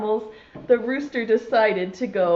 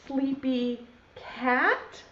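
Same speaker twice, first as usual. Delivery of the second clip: ordinary talk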